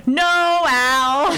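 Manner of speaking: high, drawn-out mock protest; imitated emphatic no; retold joint objection. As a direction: object as two exasperated friends at once. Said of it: "they both say at the same time".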